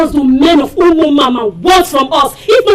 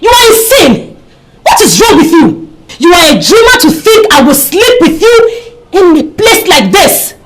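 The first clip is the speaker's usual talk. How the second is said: indignant outburst. Acted. Woman angrily refusing the man who lured her, indignant outburst. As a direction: refuse him with angry, emphatic force. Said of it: "Why are you sounding like this".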